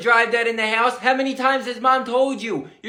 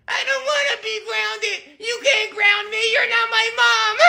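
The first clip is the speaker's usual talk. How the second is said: put-on high, bratty kid complaint; whiny little Billy; 10 semitones higher than usual. Billy whining at Grandma about being grounded, high bratty voice. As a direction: whine that she can't punish you.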